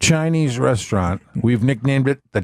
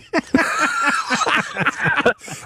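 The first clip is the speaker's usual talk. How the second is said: high breathy laughter; 12 semitones higher than usual. Real, not acted. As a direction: laugh at your own line. Laughing after punchline.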